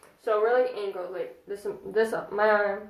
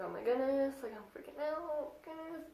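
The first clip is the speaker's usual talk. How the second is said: hushed, breathy fan excitement, higher; star-struck squeal; 6 semitones higher, 6 dB quieter than usual. Retold or imitated. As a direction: gush under your breath like a starstruck fan. Star-struck fan's inner voice.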